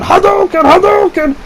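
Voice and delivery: high mock battle cry; game attack yell. Stoned friend punching a branch karate style, high mock yell. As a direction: imitate a kid yelling a fighting game move. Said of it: "I don't know why he yells this".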